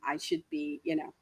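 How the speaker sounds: ordinary talk, nothing marked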